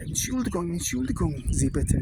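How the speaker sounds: put-on high, polite voice of an elderly German calling out; old German man. Old man to bricklayers, high polite German voice. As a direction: voice the old man politely stopping the English lads.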